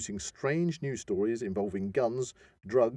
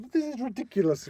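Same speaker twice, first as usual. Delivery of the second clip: mock exasperation; 12 semitones higher than usual. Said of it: "it was like".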